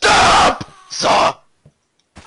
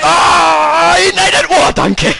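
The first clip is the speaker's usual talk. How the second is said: panicked yelling; 3 semitones higher, 10 dB louder, slower than usual. Real, not acted.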